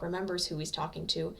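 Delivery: plain reading voice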